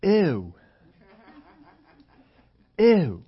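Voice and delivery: loud, high, falling "ew" of disgust. Retold or imitated. Family seeing the preacher's cereal, crying out in mock disgust. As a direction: recoil with an exaggerated ew. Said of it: "looks at that and they say".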